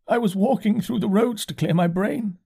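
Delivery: high, hollow curate voice; dazed curate. A narrator's reading. Curate repeating himself to the narrator, high and dazed. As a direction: repeat it high, like a man in shock.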